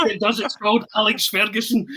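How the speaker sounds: high voice, laughing punchline; comedic rant. Retold or imitated. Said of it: "he goes on this comedic rant".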